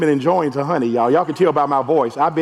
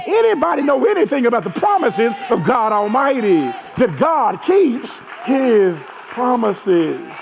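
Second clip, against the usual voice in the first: preaching; 6 semitones higher than usual. Acted. Preaching at full force.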